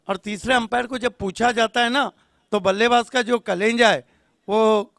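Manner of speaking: usual speaking voice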